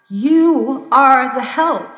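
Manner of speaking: loud, very slow command; cried out. Retold or imitated. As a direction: call up with slow insistent force. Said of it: "I cried again".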